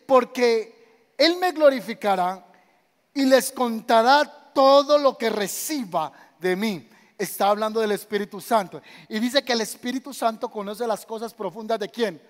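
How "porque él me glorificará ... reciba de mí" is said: slow, loud scripture quote; preaching scripture. Acted. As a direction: proclaim the verse slowly and loudly. Cita la escritura con fuerza. (lectura lenta y fuerte del versículo)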